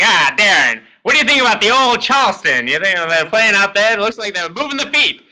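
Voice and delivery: high, hammed-up old-time East Coast reporter; mock East Coast reporter firing questions; Scoops Callahan. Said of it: "Scoops Callahan".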